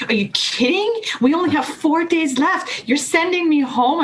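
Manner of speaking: high, indignant disbelief; outraged. Retold or imitated. Saleswoman sent home by her boss, high outraged protest. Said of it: "And I was like".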